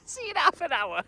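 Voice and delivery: high, laughing goodbye. Real, not acted. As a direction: laugh through it. Laughing farewell.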